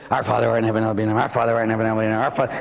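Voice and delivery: rattled-off, rushed rote prayer; real fast. Acted. Penitent hurrying through ten Our Fathers as penance, real fast. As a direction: race through the prayer in a low monotone. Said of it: "you're saying it real fast".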